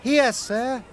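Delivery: lightly voiced proud Percy; proud little engine. A narrator's reading. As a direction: present it with pride. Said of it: "said Percy proudly".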